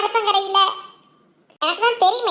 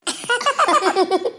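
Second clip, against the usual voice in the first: teasing laugh; 2 semitones lower than usual. Acted. Teasing sister over her dressing up, laughing. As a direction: burst of mocking laughter.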